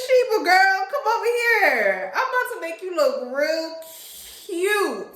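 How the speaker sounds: high, loud sweet talk to a dog; cooing to dog. Retold or imitated. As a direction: coo at the dog like a baby.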